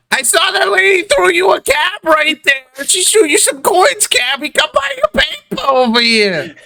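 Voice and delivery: put-on high-pitched kid hollering at a cabbie; pushy paperboy child. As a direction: pester a customer in a piping newsboy voice. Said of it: "he looks at you, he's like".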